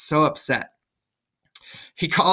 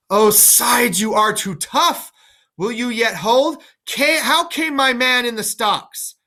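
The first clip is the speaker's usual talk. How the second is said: put-on higher, louder old king's outburst; reading Lear; 8 semitones higher, 4 dB louder than usual. Lear to his daughters, raised indignant cry. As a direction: cry out as a wounded father.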